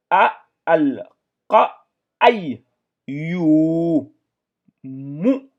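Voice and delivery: loud, high syllables, one at a time; syllables drilled. Shown as a demonstration. Teacher sounds out the verse for pupils, loud and high. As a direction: drill each syllable clearly. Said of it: "C'est pour cela qu'on va avoir".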